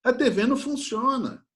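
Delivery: high, loud alarmed cry of Pai; startled daughter. Retold or imitated. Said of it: "Aí ela assustada fala".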